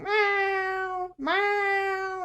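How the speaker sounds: long, high meowing; stretched-out mock meow; imitated cat meow. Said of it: "and was like".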